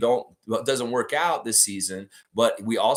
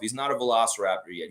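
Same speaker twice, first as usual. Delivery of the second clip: ordinary talk, nothing marked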